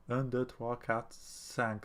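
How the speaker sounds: counting softly in French, un deux trois quatre cinq. Shown as a demonstration. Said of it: "Yeah, five".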